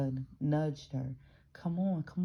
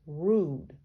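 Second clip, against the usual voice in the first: rude; 6 dB louder than usual. Real, not acted. Exasperated verdict on New Yorkers.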